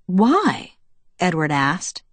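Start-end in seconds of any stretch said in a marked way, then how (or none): 0.0-0.8 high-pitched, innocently puzzled; innocent surprise. A narrator's reading. Boyfriend questions the raging father, innocently surprised. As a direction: ask as if you truly had no idea. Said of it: "his voice saturated with innocent surprise".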